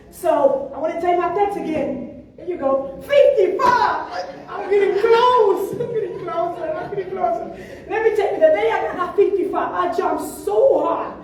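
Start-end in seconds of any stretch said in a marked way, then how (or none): 3.0-4.2 high, loud and slow, savouring the number; excited announcement. Real, not acted. Announcing her improved score. 4.5-7.4 laughing through the words; laughing happily. Real, not acted. Laughing at her progress.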